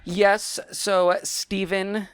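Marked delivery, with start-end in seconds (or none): none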